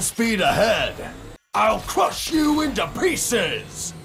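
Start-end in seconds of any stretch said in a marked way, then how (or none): 1.5-3.9 high-pitched shouted threat; threatening battle cry. Acted. Racer threatening an opponent in battle, high shouted threat. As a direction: cry it out as you charge.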